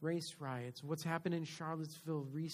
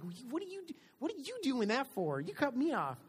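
higher, indignant complaint; mock road rage; 8 semitones higher, 3 dB louder than usual. Driver protesting at someone who cut him off, indignant complaint.

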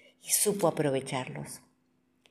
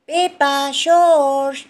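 high, loud mother's call; 9 semitones higher, 9 dB louder, much slower than usual. A narrator's reading. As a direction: call the children from afar, stretching the names. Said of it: "los llama Mamá Pig".

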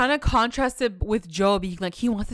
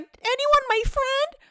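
much higher, slow, pitiful mock voice; mock lonely Candace; much slower than usual. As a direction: whine it up high and slow, a parody. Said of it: "She's like".

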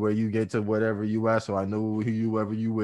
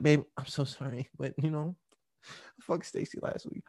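apologetic tone; 3 semitones higher, 7 dB quieter than usual. Retold or imitated. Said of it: "in any different tone".

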